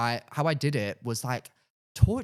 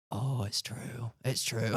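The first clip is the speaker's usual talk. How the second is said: slow, softer, stunned murmur; hushed realisation; 3 semitones lower, 6 dB quieter, slower than usual. Retold or imitated. Facing the mirror the morning after, hushed stunned realisation. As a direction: breathe it as it sinks in.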